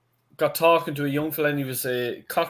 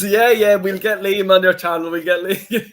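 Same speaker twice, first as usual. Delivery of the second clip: laughing through the quote, higher and louder; chuckling as he mimics the lad's eager promise; laughing, imitating young fella; 5 semitones higher, 6 dB louder than usual. Man retelling young fella's promise to friends, laughing.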